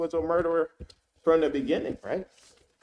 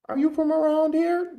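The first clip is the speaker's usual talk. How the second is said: high mocking voice of the woman; mimicking her question; 14 semitones higher, 4 dB louder than usual. Commentator quoting the woman asking the homeowner, high mock voice. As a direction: ask it in her suspicious tone, pitched up. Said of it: "that's what she said that she said".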